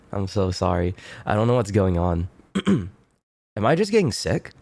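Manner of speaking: ordinary delivery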